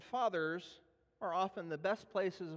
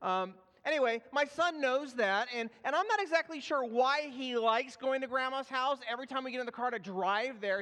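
normal talking voice

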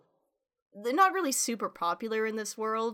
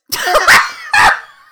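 loud, high burst of real laughter; 18 dB louder than usual. Real, not acted. Cohost reacts to the thought of Dopey having sex, helpless laughter.

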